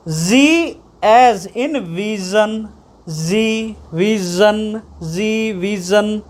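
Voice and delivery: "Z as in vision" stretched slowly, "vision" repeated; slow pronunciation drill. Shown as a demonstration. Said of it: "है ना".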